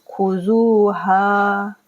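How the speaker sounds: slow recited word; Quran recitation. Shown as a demonstration. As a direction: chant the word slowly. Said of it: "दोनों को खींचना है".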